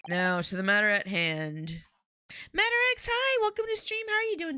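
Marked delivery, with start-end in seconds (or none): 2.5-4.6 very high cheery welcome; bright greeting. Real, not acted. Streamer welcomes a new viewer, very high cheery greeting.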